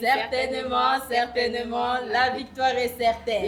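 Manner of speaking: high chanted response; town crier. Acted. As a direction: answer like a herald.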